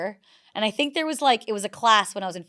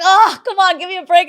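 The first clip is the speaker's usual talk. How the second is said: mock protest; 10 semitones higher, 10 dB louder than usual. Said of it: "I'm like".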